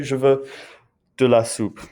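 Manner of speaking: speaking through hiccups; hiccuping. Real, not acted. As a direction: hiccups breaking the words. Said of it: "Sorry, I have hiccups right now".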